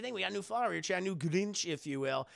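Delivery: ordinary talk, nothing marked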